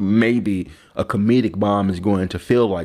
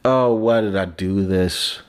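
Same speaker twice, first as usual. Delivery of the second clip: ordinary talk, nothing marked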